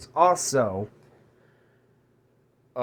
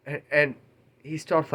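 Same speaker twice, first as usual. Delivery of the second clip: ordinary talk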